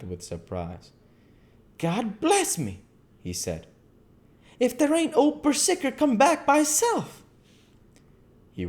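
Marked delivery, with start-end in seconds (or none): 1.8-2.8 slow, higher, astonished keeper; the keeper seeing his wolf return; surprised exclamation. A narrator's reading. 4.6-7.2 much higher, louder, delighted disbelief; the keeper spotting Bersicker at the window; amazed keeper. A narrator's reading. Keeper amazed wolf returned.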